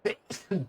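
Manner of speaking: sudden sharp sneeze; sneezing. Real, not acted. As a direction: let a real sneeze burst out. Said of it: "Oh, I'm gonna sneeze".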